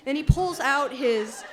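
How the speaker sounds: slow, laughing as she tells it. Real, not acted. Daughter cracking up retelling the search, laughing. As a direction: laugh your way through the words.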